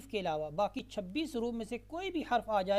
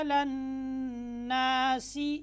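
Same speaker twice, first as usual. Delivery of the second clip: slow melodic chanted recitation, higher and louder; full recitation; 4 semitones higher, 7 dB louder, much slower than usual. Shown as a demonstration. Teacher recites the complete verse again for students. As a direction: recite the whole verse slowly in tones. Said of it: "अब हम दोबारा मुकम्मल पढ़ते हैं".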